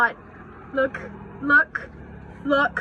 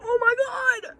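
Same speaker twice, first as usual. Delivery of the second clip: high squeal; 14 semitones higher, 6 dB louder than usual. Real, not acted. Reacting to the motorcycle guy.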